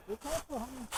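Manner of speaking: very high motherly voice; worried mom voice. Pretend. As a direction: coo it like a fussing mother. Pretend phone call, mom answers.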